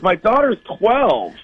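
high, drawn-out mock indignation; slow, high-pitched retold protest; outraged father. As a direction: act out an appalled dad, stretching it out.

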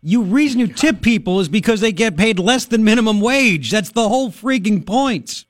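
high-pitched, incredulous outburst; indignant, worked-up radio host; exasperated rant. Real, not acted. Heated rant about tipping cooks.

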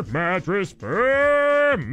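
each syllable stretched slowly; slow stretched jingle-like call; drawn-out brand call. Shown as a demonstration.

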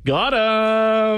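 high, drawn-out victory crow; triumphant, held-out gloat; gleeful gotcha. Real, not acted. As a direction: stretch it out like you just caught someone. Crows after winning the prank call.

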